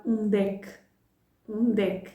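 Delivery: "undec" said as one word, both final e's dropped; linked onde é que. Shown as a demonstration. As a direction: run "undec" together, ending on k. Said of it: "the final E drops".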